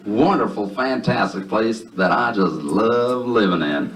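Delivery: put-on heavy Southern accent with stretched words; hammed-up Southern gentleman; thick Southern drawl. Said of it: "in a thick, heavy Southern drawl".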